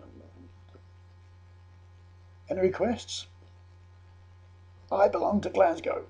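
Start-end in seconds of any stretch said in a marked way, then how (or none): 2.5-3.3 higher, louder call for requests. Retold or imitated. Singer asks pub crowd from the stage, calling out. 4.9-6.1 high, loud call from the crowd; shouted request. Retold or imitated. Pub patron answers singer's call for requests, shouting.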